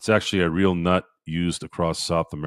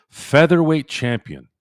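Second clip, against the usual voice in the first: higher, slow ring-announcer "Featherweight champion"; announcer call; 9 semitones higher, 5 dB louder, slower than usual. Shown as a demonstration. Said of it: "announcer-style repeat".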